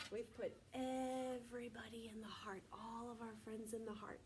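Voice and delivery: soft unvoiced whisper; gentle classroom whisper. Shown as a demonstration. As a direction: hush it like a secret to little kids. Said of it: "teacher whispering to class".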